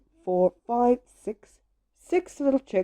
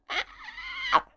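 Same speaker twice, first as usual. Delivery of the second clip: very high, thin screech; sustained high-pitched squeal, kept soft; cat screech; 5 dB quieter than usual. A narrator's reading. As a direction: imitate a screeching cat up in the top of the voice. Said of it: "it's a screeching cat".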